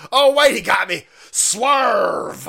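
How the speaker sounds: high, loud mock surprise; hammed-up, drawn-out reveal; loud exclamation. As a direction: shout it as a gotcha. Celebrating being fooled by fake apology.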